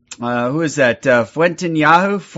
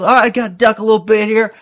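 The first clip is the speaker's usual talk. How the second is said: urgent quarterback call; 4 semitones higher, faster than usual. Retold or imitated. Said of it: "quarterback scrambling".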